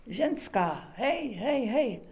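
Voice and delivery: urgent, rising calls of hej while shaking her; anxious calling. Acted. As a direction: shake her and call out in growing panic. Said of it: "to už s ní nutně třás". (naléhavé, vyděšené volání při třesení)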